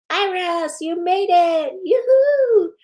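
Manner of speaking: high, loud whooping welcome; excited greeting. Real, not acted. Host cheering a viewer who made it, high excited call. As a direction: call out in delight to a friend who just showed up.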